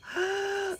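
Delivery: sharp high gasp of disgust; shocked high intake of breath; horrified gasp. Real, not acted. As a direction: gasp as if something gross is in front of you. Horrified at pumpkin jello.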